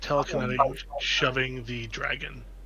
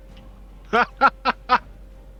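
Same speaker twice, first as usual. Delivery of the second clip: high burst of laughter; delighted laugh; 11 semitones higher, 6 dB louder than usual. Real, not acted. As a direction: laugh out loud at the silly luck. Silly spell works.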